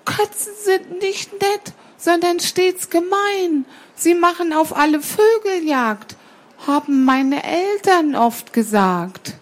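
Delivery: high, reciting little bird voice; little sparrow. Acted. Sparrow child recalls parents' warning about cats, high reciting.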